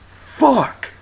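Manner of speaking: high-pitched mock panic outburst; acted panic. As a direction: blurt it out high, acting alarmed. Acted reaction to the fairy swarm.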